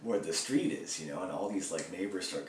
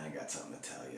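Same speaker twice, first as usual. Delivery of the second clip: low, hushed confession to a roommate; telling a roommate a secret in a whisper; 7 semitones lower, 8 dB quieter, much faster than usual. Retold or imitated. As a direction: breathe it out like a secret.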